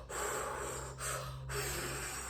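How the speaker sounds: long, soft blown-out breaths; re-enacted labour breathing. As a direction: imitate puffing slowly through a contraction. Acts out breathing through contractions.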